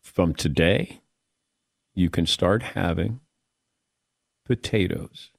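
low, soft, drawn-out pronouncement; a hushed, weighty doctor's decree; solemn doctor verdict. Retold or imitated. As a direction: announce it like a grave ruling. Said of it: "He goes".

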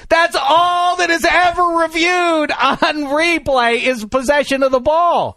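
high, loud, drawn-out protest; exasperated shouted insistence; flustered outrage. Real, not acted. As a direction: hammer it out slowly, high and loud. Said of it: "I'm sorry, I'm flustered".

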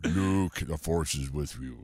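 a hint of Vader menace; Darth Vader voice. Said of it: "We got Darth Vader breathing".

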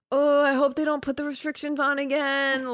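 mock a pampered complainer; exaggerated high moaning; whiny reality star. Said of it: "the type of people who are like".

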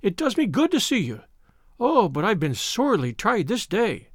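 ordinary delivery, nothing marked

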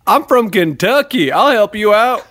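put-on Kentucky accent. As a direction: offer help in a Kentucky accent. Character introduces himself as Kentuckian.

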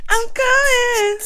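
high, slow, drawn-out call; singsong mock summons reply; playful calling out. Playfully calling out over imitated dings.

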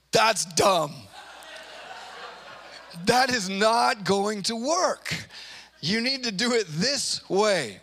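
slow, emphatic dismissal; acted scornful objection, drawn out; dismissive. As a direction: flatly reject it, word by word. Acts people scorning God's ways.

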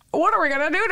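playful high doggy voice; Minnow. Retold or imitated. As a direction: imitate a partner talking as the dog. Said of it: "he has this voice with Minnow".

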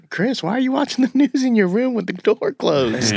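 very high-pitched mock mother; nagging mom voice. Teasing Chris as his mother at the door, high nagging voice. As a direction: call out in a high put-on mom voice. Acting a mother calling to son.

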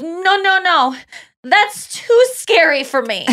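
put-on high, slow little-kid voice backing away; small child refusing the scary pumpkin; scared little boy. Little boy telling his dad no, high and slow. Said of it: "And then he just goes".

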